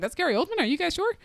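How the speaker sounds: high, disbelieving questions; can't believe it's him; mock disbelief at a trailer; incredulous.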